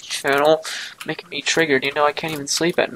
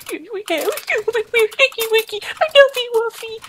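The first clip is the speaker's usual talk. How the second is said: squealing; 7 dB louder than usual. Acted. Silly squeal to fan.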